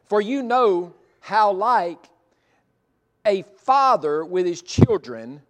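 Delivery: slow, loud, every word weighed; deliberate preacher's read-through; slow emphatic reading. Shown as a demonstration. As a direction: lean into each word of the verse. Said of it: "here's what I was getting toward".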